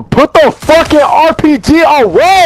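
real exasperated, high-pitched yell; angry yell. Real, not acted. Player ordering a teammate to stop, angry yell. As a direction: drag it out, high and fed up.

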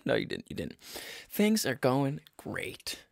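a dad smoothing things over; reassuring father. Acted. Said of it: "This is my father".

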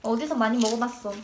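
talking between bites; chewing while talking. Real, not acted. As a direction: mouth partly full. Seen 0: man chews chips, eyes closed, mouth working while speaking.

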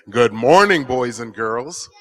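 big, loud good morning. Real, not acted. Children's preacher re-greets kids who barely answered, loud. Repeats greeting for louder response.

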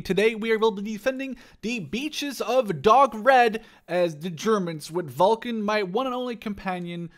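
big showman welcome to the viewers; YouTube intro. Acted. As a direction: greet the viewers like a hyped host. YouTuber opening the video.

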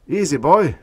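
high, loud urgent caution; urgent hiss. A narrator's reading. Stetson cautioning Orn, urgent hiss. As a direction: rein him in, high and urgent. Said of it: "hissed Stetson".

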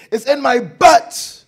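high, loud, stretched-out cry; mimicked labor wail. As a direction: wail it slowly as the wife in labor. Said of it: "Just like that".

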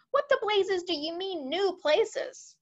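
high-pitched, exasperated storybook outburst; exasperated shout. A narrator's reading. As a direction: demand an answer as a flustered commander. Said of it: "shouted the head of the Air Force".

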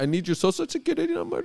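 very high, quick mock Indian agent; squeaky-high Indian agent impression; Indian accent. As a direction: rattle it off in an imitated Indian accent. Mimics Indian agent asking.

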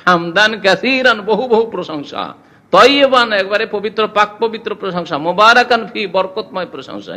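glowing, stretched-out praise; fervent praise. Retold or imitated. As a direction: lift each phrase of praise up high. Companion's lengthened praise retold.

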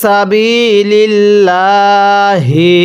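সাবিলিল্লাহি drawn out slowly with a rising end; slow recitation. Shown as a demonstration. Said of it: "হ্যাঁ পড়ুন". (ধীরে টেনে সাবিলিল্লাহি)

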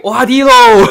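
high, loud mock army yell; drive-by shout. Streamer imitating a drive-by heckler for viewers, high full shout. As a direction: holler it as if from a passing car. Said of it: "Got one guy drive by shout".

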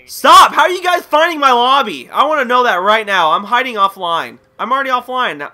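loud, high-pitched protest; exasperated shouting. Real, not acted. Gamer to people finding his lobby, exasperated shout. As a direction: shout it in fed-up disbelief.

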